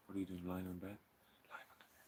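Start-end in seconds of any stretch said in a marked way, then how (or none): 1.5-2.0 unvoiced whispered repeat; soft whisper. Real, not acted. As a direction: hush it to yourself. Whispered correction.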